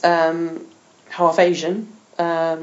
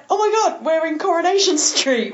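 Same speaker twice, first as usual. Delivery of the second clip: higher, mock-shocked realisation; mock surprised exclamation; 9 semitones higher than usual. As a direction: gasp it out like a sudden discovery. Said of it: "we were like".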